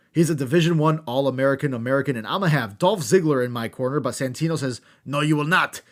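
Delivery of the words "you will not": ordinary delivery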